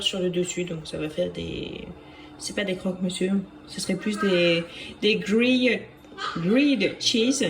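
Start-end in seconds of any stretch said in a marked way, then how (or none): 5.0-7.4 careful, slow attempt at English "grilled cheese"; drawn-out English attempt from a French speaker; attempted English accent. Shown as a demonstration. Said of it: "Excusez mon accent".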